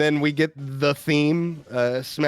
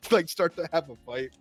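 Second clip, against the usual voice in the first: usual speaking voice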